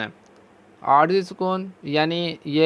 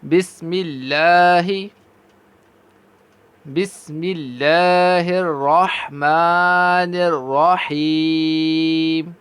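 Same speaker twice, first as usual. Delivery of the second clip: very slow, drawn-out recitation; slow tajweed chant; Quranic recitation; much slower than usual. Shown as a demonstration. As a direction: chant the basmala slowly. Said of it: "Tasmiya.".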